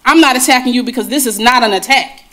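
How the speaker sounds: usual speaking voice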